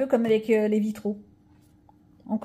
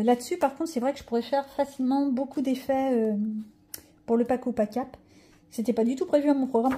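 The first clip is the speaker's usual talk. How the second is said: normal talking voice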